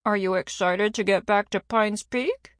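talking through a mouthful; mouth full. A narrator's reading. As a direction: say it around a bite of pizza. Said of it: "Alice asked with her mouth full".

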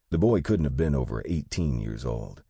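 usual speaking voice

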